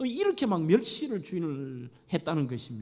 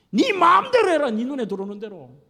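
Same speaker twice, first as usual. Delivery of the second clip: much higher, louder fed-up outburst, dropping at the end; angry snap; 5 semitones higher, 7 dB louder than usual. Acted. Husband snapping back at his wife. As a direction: throw it back at her, exasperated. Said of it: "톤이 높은 거예요".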